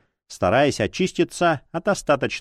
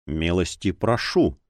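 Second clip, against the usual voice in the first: low, slow, kindly welcome; good-natured soft male voice; 8 semitones lower than usual. A narrator's reading. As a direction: invite them in gently, unhurried and low. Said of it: "позвал добродушный мягкий мужской голос".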